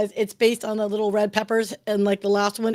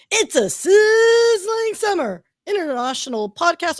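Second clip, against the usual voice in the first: slow, stretched announcer voice, pitched up; movie trailer voiceover; 7 semitones higher, 4 dB louder, slower than usual. Acted. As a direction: sell it like a hot summer blockbuster promo. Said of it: "I did that voiceover".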